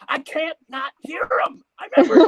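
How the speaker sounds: exasperated God. Acted. God yells back at nagging partner, high and exasperated. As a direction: blow up in a high, strained comic yell.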